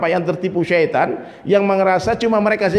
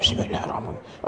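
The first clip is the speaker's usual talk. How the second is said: voiceless, much softer mock prayer; whispered prayer imitation; 9 dB quieter than usual. Worshipper beside him starts praying with bad breath, imitated whisper. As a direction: whisper it like someone praying alongside.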